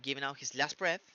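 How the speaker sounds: LAST BREATH joined, no T at all in LAST; dropped T. Shown as a demonstration. Teacher asking students to listen closely, dropped T in LAST. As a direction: say "giving out his last breath" fluidly, skipping the T of LAST. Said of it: "nunca existió la T".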